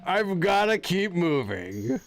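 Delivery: very slow, high, drawn-out words; dramatic game character. Acted. Streamer voicing a game line, high and drawn-out. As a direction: wail it slowly and theatrically.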